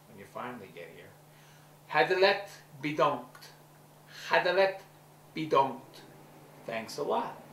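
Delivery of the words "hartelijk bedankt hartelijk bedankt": "Hartelijk bedankt" pronounced in careful Dutch for a learner; Dutch lesson demo. Shown as a demonstration. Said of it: "give A a little Dutch lesson".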